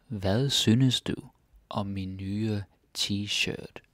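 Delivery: slow, careful reading of each word; slowly. Shown as a demonstration. Teacher rereading the Danish line for students, slowly. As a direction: draw the sentence out so learners can follow. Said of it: "let's do it slowly".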